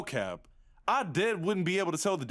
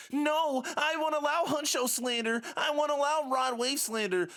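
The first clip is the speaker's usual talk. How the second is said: defensive fan; 9 semitones higher than usual. Retold or imitated.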